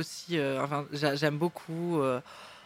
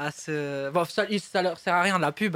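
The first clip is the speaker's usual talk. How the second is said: ordinary delivery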